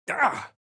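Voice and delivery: a short high yelp; startled cry. Acted. Hero startled by the wounded creature, sharp cry. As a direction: gasp out in sudden shock.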